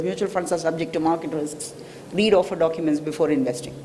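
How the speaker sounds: fast, low, soft recital of small print; quickly said disclaimer. Retold or imitated. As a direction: rattle it off like a mandatory ad disclaimer. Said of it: "quickly said".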